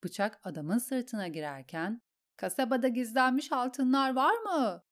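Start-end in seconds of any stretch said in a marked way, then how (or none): 2.4-4.8 high, frenzied yell while stabbing. A narrator's reading. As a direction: scream the questions at him. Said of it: "diye bağırdı Arya". (kızgınca bağırarak)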